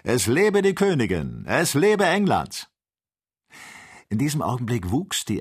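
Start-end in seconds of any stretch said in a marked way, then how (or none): 0.0-2.7 loud, high rallying cheer; resounding cheer. Acted. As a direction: cheer it out like a patriotic crowd. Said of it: "Ein lautschallendes Hurra erklang".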